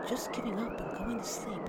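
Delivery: drowsy, breathless whisper. Retold or imitated. Imagining giving up in the snow, whispered. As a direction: let the words fade into a whisper.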